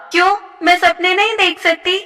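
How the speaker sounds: put-on hurt young girl. A teased girl answering her bully, hurt. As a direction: push back though hurt.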